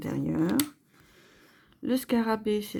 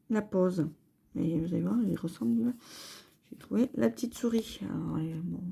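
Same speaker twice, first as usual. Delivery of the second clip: ordinary delivery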